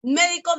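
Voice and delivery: "médico" high on the first syllable; stress on MÉ of médico. Shown as a demonstration. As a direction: hit MÉ in "médico". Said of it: "el acento cumple función distintiva". (acento en MÉ de «médico»)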